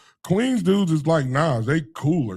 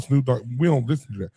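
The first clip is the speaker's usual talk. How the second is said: ordinary talk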